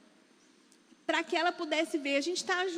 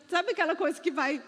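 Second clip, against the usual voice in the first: ordinary talk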